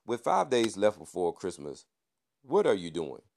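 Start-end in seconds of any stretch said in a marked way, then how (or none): none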